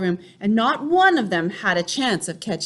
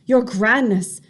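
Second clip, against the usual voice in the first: called back; 4 semitones higher, 4 dB louder than usual. A narrator's reading. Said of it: "someone called back to her".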